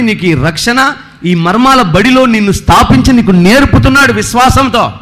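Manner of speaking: loud, driving pulpit preaching; full-force preaching. Acted. Preacher exhorting the congregation, loud and fervent. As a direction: preach it out to the whole church.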